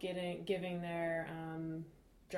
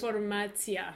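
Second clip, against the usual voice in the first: Russian word in a Russian accent; 2 semitones higher, 6 dB louder than usual. Shown as a demonstration. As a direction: say Desinformatia the Russian way. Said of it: "how's your Russian accent?".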